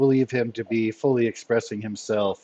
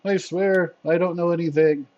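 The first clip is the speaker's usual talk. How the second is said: higher-pitched earnest protest; frightened henchman; 7 semitones higher than usual. Acted. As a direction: swear innocence as a nervous captive. Frightened henchman swears ignorance.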